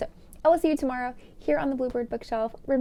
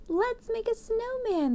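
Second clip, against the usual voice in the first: high excited call; children crying out; 12 semitones higher than usual. A narrator's reading. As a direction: call it out like eager kids. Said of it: "the children cried".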